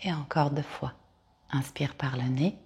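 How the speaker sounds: calm Yoga Nidra guide with long pauses; guided meditation. Acted. Yoga teacher guiding listeners to lie down and release, guided meditation. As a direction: lead them into rest, phrase by unhurried phrase.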